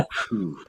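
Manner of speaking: soft "phew" of relief; hushed, relieved puff; relieved exhale. Retold or imitated. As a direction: blow out a quiet breath of relief. Retold relief after her praise.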